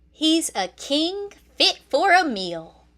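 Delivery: a hint of a sneering hyena voice; lightly voiced hyena mocking the cub; snickering hyena. A narrator's reading. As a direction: sneer it like you're licking your lips. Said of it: "Banzai snickered".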